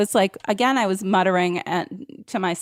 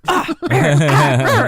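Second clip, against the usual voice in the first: slow low louder grumble; angry grumbling; 7 semitones lower, 4 dB louder, slower than usual. Retold or imitated. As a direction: grumble like a fuming walker.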